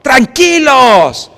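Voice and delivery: high, loud hail stretched slowly; shouting. Acted. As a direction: call out over the storm. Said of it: "Tuvo que gritarles".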